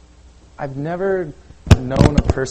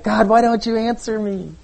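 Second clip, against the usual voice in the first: frustrated plea; 10 semitones higher, 4 dB louder than usual. Retold or imitated.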